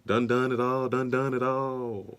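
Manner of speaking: drawn-out put-on rapper voice; loosely mimicked rapper delivery, slowing down. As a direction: imitate a rapper's catchphrase, stretching the last words. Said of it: "In my [name] voice".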